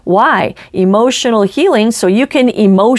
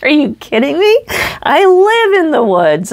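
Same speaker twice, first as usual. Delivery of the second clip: high-pitched, mock-incredulous comeback; retold disbelief; 7 semitones higher than usual. Woods dweller to someone who's never camped, incredulous. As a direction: act out astonishment with pitch jumping up. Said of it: "I just couldn't believe it".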